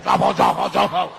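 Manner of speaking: very low, distorted mocking chant; mimicked card-game taunt. Angry player mimicking the opponent's taunt, very low mocking voice.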